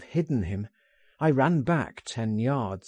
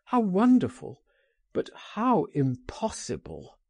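higher-pitched cry of wonder, falling to dismay; 7 semitones higher than usual. A narrator's reading. Oscar answers Frank's tempting invitation, crying out in wonder. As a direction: exclaim it, torn between delight and doubt. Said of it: "he cried".

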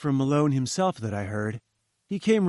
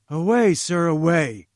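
louder, higher, angry command to leave; angry dismissal; 4 semitones higher, 6 dB louder than usual. Acted. Challenger angrily orders Jones out, loud angry shout. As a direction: drive him out of the room with your voice. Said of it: "he cried angrily".

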